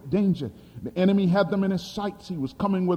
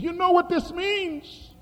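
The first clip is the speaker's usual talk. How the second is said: high, slow, ominous question; a trembling woman asks, slowly and high; woman's voice, high; 13 semitones higher than usual. Retold or imitated. Hungarian woman quoted, high.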